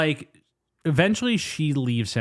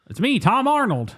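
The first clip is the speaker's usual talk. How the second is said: high-pitched mock character impression; Tom Arnold; 12 semitones higher, 4 dB louder than usual. Podcaster mimics the actor for a laugh, high put-on voice. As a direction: announce yourself in a high, put-on voice. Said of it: "that's my Tom Arnold impression".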